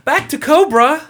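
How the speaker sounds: high, loud quoted catchphrase; quoting Autumn. Retold or imitated. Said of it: "to quote Autumn".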